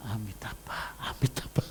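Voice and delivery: soft, fading into a whisper. Retold or imitated. Mother wondering about the odd name, whispered. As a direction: puzzle it out under your breath. Retelling confused question.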